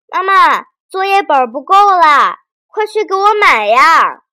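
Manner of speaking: high, loud urgent shout; boy shouting for mom. A narrator's reading. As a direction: yell to mom from the desk, hurrying her. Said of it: "连忙喊妈妈". (孩子着急地大声喊妈妈)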